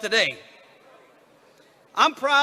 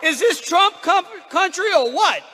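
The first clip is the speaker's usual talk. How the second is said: excited crowd greeting; 9 semitones higher than usual. Real, not acted. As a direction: fire up the crowd.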